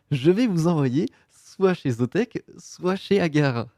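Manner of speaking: slowed right down, word by word; slow motion speech. Shown as a demonstration. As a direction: say it slowly to get it right. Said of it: "je l'ai dit au ralenti".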